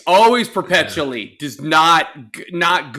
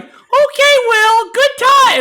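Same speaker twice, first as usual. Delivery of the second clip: much higher and louder, slow mock mom voice; imitating mom; 6 dB louder, slower than usual. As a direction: play the clueless mom sweetly. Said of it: "your mom being like".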